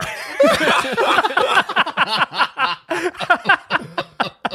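long, high burst of laughter. Real, not acted. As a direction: crack up at your own punchline. Punchline of his own story.

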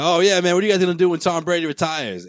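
a smug fan taunting Boston guys about Brady; mock taunting stranger. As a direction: tease like a smug out-of-towner. Imitating an out-of-town heckler.

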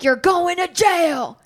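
loud, high, drawn-out mock threat; hammed-up furious father; pissed-off dad. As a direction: imitate an angry dad laying down a threat. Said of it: "my dad is pissed".

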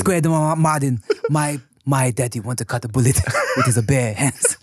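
put-on comic Hong Kong accent breaking into laughter; laughing action-star accent impression; Jackie Chan. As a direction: put on a Cantonese-English accent, barely holding the laugh. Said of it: "I could do a decent one".

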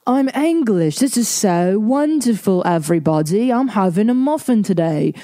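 exaggerated, high-pitched bad English accent; Renée Zellweger's English accent. Mocking an actress's first-movie attempt, hammed-up English accent. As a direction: ham up a fake, singsong British accent. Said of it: "the English accent".

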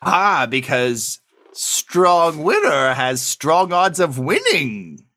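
high, slow put-on bookie voice; a squeaky-high NPC clerk, slowing down; booking agent voice. Booking agent explains the odds.

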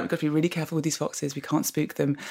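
quiet, fast hushed warning; soft urgent hush; hushed caution. Retold or imitated. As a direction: keep it down so nothing gets spooked. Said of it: "everyone was like".

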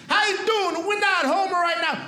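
high mock dad voice recording a greeting; chirpy but gruff answering-machine greeting; dad recording the outgoing message in a high mock voice; impression of dad. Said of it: "Quick impression of my parents leaving the outgoing message".

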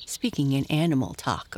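usual speaking voice

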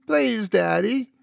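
put-on high, slow childlike begging; little girl pleading. A narrator's reading. Daughter begging daddy through tears, high and slow. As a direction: stretch the please like a hurt child.

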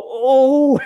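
a high, loud "Oh"; startled wince at a tackle; shocked gasp. Retold or imitated. Reacting to a wild tackle.

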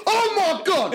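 high, loud mock yell of horror; panicked shout. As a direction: imitate a mate's horrified outburst. Retelling friend's panic at the barrier.

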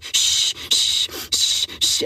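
sharp unvoiced "shh" over and over; repeated shushing. Guest shushes the voice warning her on date one, insistent hushing.

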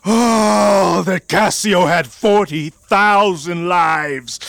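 loud, raging cry for revenge; furious shout. Acted. Betrayed husband cursing Cassio, furious shout. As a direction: spit out his rival's name.

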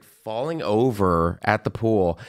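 normal talking voice

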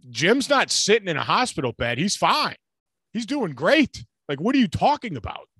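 exasperated rant. Real, not acted. Ranting, mocking the coach.

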